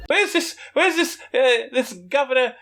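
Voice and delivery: put-on a failed, high-pitched Irish accent; a botched attempt at an Irish brogue, pitched high; bad Irish accent. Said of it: "I can't even do an Irish accent".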